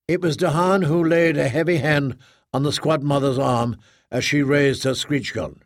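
ordinary delivery, nothing marked

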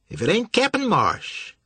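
high, slow sneering voice; gloating villain. A narrator's reading. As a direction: savor the capture. Villain gloating behind Marsh.

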